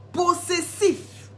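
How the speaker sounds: a single, deliberately slow possessif; stress on possessif. Shown as a demonstration. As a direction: stretch out possessif as the key word. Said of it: "repeats the key word alone".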